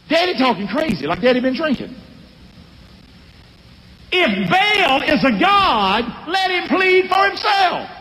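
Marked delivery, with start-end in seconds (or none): 4.1-7.9 slow, then shouted challenge, much higher; defiant preaching shout. Retold or imitated. Pastor voicing the father shouting at the mob, higher. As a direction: throw the challenge at the crowd.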